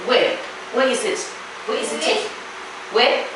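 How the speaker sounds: normal talking voice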